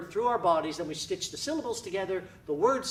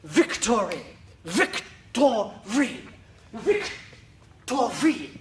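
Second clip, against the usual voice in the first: slow, loud, stretched "Victory"; loud embodied; 3 semitones higher, 6 dB louder, much slower than usual. Shown as a demonstration. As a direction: project "Victory" across the room. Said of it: "That word literally becomes embodied".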